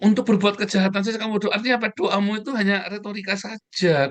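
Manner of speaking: high, fast, driving rebuke; preaching rebuke. Real, not acted. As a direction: scold the hypocrites from the pulpit. Preacher rebuking hypocritical prayer.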